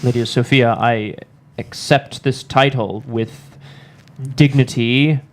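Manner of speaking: formal speech. Acted.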